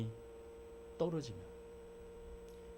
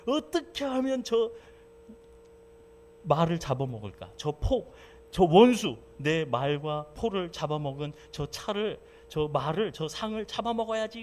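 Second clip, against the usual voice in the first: scheming revenge; 4 semitones higher, 6 dB louder than usual. Retold or imitated. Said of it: "씩씩거리면서".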